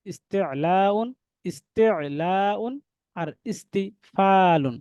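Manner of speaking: ইস্তিলাউন and ইস্তিফালুন drawn out very slowly, each syllable held; very slow tajweed demonstration; slow recitation demo. Shown as a demonstration. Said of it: "তৃতীয় জোড়ার এক নম্বরে হচ্ছে ইস্তিলাউন".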